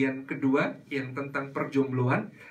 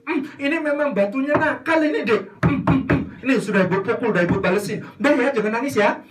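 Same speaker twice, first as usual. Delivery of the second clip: higher, louder mock cooing; mother blaming the stone to soothe her child; soothing mother; 7 semitones higher, 5 dB louder than usual. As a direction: coo over the crying child. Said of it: "ada orang tua".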